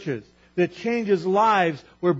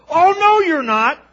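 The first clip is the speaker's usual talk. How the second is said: much higher and louder indignant protest; Peter protesting; 10 semitones higher, 12 dB louder, slower than usual. Retold or imitated. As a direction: shout down the very idea. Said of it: "And Peter goes".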